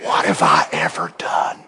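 low, hushed and humble; barely breathed, humble question; soft humble murmur. Retold or imitated. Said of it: "say humbly and truthfully".

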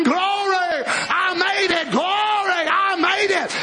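drawn-out preacher's shout of glory; exultant hollering. Pretend. Imagining himself screaming on the new earth, exultant hollering. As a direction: holler it out in triumph, stretching the word. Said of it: "I'll be screaming and hollering".

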